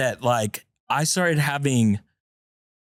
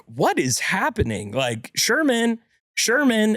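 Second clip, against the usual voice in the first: worried wife calling; 7 semitones higher than usual. Retold or imitated. Wife calling from the other room.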